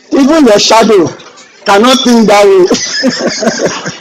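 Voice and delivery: slow, laughing disbelief. Real, not acted. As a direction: drag it out, laughing. Laughing at old strictness.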